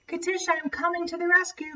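high, fast, rushed rescue call; urgent heroic cry. Acted. Clara racing to save Katisha, a high fast cry. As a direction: dash in to save her friend. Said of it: "cried Clara".

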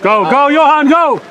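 loud, high cheer across the field; yelling from the sidelines. Real, not acted. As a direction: shout him on toward goal.

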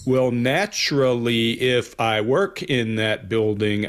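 slow, drawn-out imitation of his answer; slow smug drawl imitation; slowed cadence. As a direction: stretch each word out slowly, a bit smug. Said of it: "he goes to that slowed cadence".

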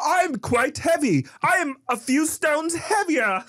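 high, slow, stretched British vowels; British-accented baby. Acted. Baby talking to nanny, high slow British accent. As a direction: declare it as a squeaky British baby. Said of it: "busted out with this British accent".

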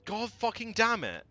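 loud, high enraged yelling; real fury, loud and high; furious shout. Real, not acted. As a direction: rage at the game. General killed, raging.

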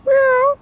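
very high squeaky meow; cat meow. Pretend. Calling to the cat with a high meow. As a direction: call the cat with a tiny meow.

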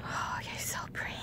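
fast, breathy whisper. Real, not acted. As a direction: whisper it fondly. Whispering to the pet.

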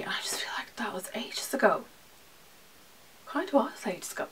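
breathy hushed whisper; wistful whisper to the camera; whispered aside. Real, not acted. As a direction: drop to a whisper as if remembering. Dreamy aside about Spain trip.

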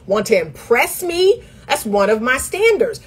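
normal talking voice

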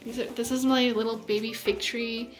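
ordinary delivery

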